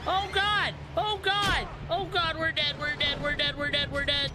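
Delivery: high frantic panic; panicked screaming. Real, not acted. Panic as enemy attacks.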